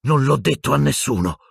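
high, indignant outcry; shrieked. A narrator's reading. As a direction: cry it out high, betrayed. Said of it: "strillò Hermione".

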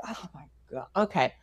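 very low, barely voiced aside; exasperated mutter. Wife caught out by her husband, exasperated mutter. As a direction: mutter it under your breath, caught out.

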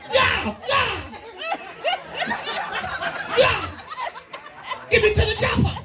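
high, excited yelps; hammed-up ecstasy. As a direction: squeal it like he's losing it. Voicing himself in bed.

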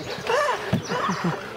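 very high mock scream of a little baby; imitated baby scream. Storyteller imitating Summit as the dog barrels in, high baby scream. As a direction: squeal high like a screaming toddler. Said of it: "Summit's just screaming".

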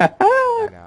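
high squeal through laughter; laughing squeal. Real, not acted. Squeal after arrow in head.

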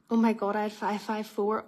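usual speaking voice

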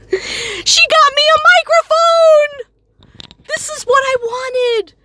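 thrilled squeal over the gift; overjoyed. Real, not acted. Vlogger thanking friend for a microphone, squealing with joy.

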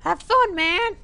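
put-on very high falsetto child; squeaky kid voice. Kid sending dad off on the swing, squeaky kid voice. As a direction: pipe up in a tiny falsetto.

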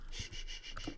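whispered shh; shushing. Real, not acted. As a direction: hush it in a whisper. Shushing the music.

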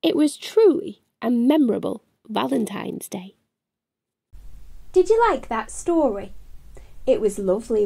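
ordinary delivery

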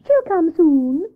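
high, slow storybook squirrel; Squirrel character voice. Acted. Squirrel answers Hare about the missing rabbit, high character voice. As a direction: draw it out in a high, loud Squirrel voice.

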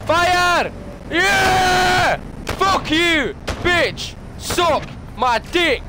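high gloating shout; triumphant yelling. Real, not acted. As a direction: roar in victory. Triumphant kill celebration.

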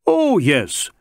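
lightly voiced, willing suspect; prompt agreement. A narrator's reading. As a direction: agree at once, almost eagerly. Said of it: "said the Sikh promptly".

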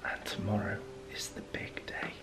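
whispering so Monica upstairs won't hear. Real, not acted. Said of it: "I've gotta be really quiet".

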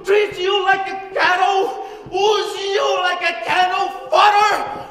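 impassioned shouting, pitched high; roaring appeal to the troops; ranting dictator speech. Acted.

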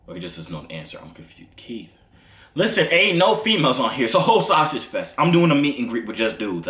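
ordinary talk, nothing marked

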